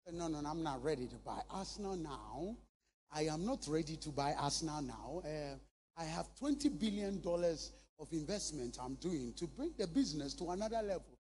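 a hint of an unhurried billionaire; Dangote's calm reply. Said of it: "He's talking to me".

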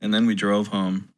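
ordinary talk

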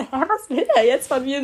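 loud, high bewildered outburst with a laugh; exasperated and amused disbelief; baffled. Retold or imitated. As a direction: laugh through your confusion. Baffled reaction to repeated question.

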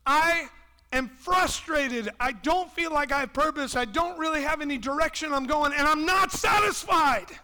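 mock frustrated shout; frustrated complaint. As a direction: vent a believer's frustration. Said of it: "we're like".

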